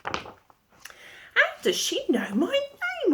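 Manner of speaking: very high, slow puzzled voice; wondering Jack. A narrator's reading. Jack puzzles over the talking hen, slow and high. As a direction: wonder it aloud, drawn out. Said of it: "wondered Jack".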